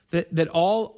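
normal talking voice

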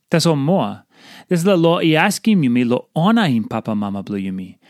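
ordinary talk

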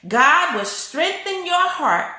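strengthen your heart drawn out slow; slow emphatic preaching. Real, not acted. Pastor urging the church to repeat, slow emphatic preaching. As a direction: preach it slowly, each word weighted. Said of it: "someone repeat that".

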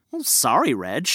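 ordinary delivery

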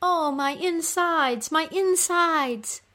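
high, drawn-out wail of pain; moaning cry. Acted. Goddess in labor-like agony in the underworld, moaning cry. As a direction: moan it slowly, high, like labor pains. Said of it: "when she cries out".

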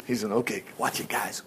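very soft, low, nearly voiceless caution; secretive heads-up under the breath; hushed warning. Acted. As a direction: keep it down as you warn the men. David warns his men covertly.

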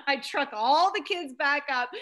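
usual speaking voice

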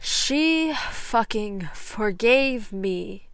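slow, loud, bitter cry of disbelief; anguished outburst. Acted. Severus to his therapist, a loud anguished outburst. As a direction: drag it out, stunned and furious.

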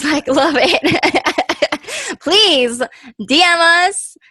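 laughing with delight while thanking listeners. Real, not acted.

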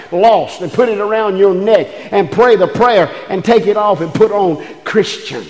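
ordinary talk, nothing marked

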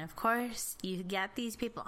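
ordinary delivery

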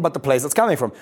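usual speaking voice

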